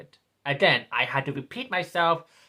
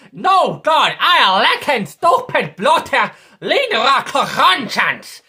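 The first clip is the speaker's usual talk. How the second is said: put-on angry Irish accent, louder and higher; Irish outburst; 7 semitones higher, 8 dB louder, slower than usual. Ranting at the left in an angry Irish accent. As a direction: let the Irish out in an angry rant. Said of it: "that was the Irish in me".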